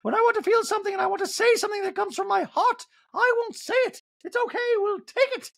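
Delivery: very high, dramatic put-on voice; affected high voice, emphatic; Dorit. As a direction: declare it in a martyred high-pitched impression. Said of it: "Dorit goes".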